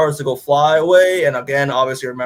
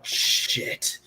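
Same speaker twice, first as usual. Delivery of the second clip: panicked whisper; 8 dB quieter than usual. Retold or imitated. Head's just like, internal panic.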